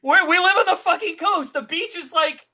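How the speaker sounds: high, loud, agitated insistence; real alarm. Real, not acted. Co-host frets about living on the coast, high agitated alarm.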